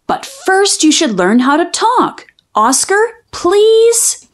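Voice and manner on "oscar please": higher, slow begging please; drawn-out plea. Acted. Child begging her dog to talk, slow pleading.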